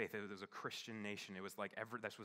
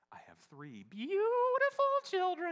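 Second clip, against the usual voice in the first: put-on much higher and louder, slow, wavering elderly voice; old lady's voice; 14 dB louder, slower than usual. Imitating the old lady starting her story over, high and slow. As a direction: start the same story again as a forgetful granny. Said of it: "And then she'd say".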